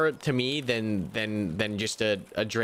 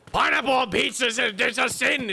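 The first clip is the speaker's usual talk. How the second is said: high, loud, scandalized pronouncement; mock outraged purist; 12 semitones higher, 5 dB louder than usual. Streamer mocking pineapple-pizza haters, scandalized. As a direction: declare it like a mortal sin. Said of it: "mocking pizza purist".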